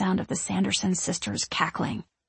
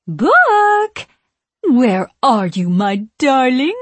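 witch calling; 5 semitones higher, 9 dB louder, much slower than usual. A narrator's reading. Witch calls for her book.

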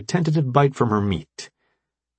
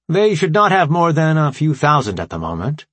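formal AI commander, raised and emphatic; brief the allies in a higher, deliberate voice; Alpha Prime; 7 semitones higher, 4 dB louder than usual. Acted. Voices the AI leader answering.

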